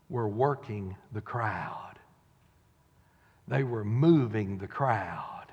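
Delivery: slow, hushed whisper. Acted. Preacher whispering how the Pharisees worked the crowd. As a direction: lean in and whisper it.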